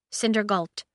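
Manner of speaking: ordinary delivery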